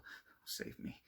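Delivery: slow, whispered plea; terrified whisper. A narrator's reading. Victor pleads in a whisper as the spectre nears. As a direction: beg under the breath, frozen with fear.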